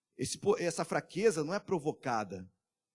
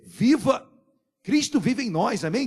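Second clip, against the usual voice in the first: ordinary delivery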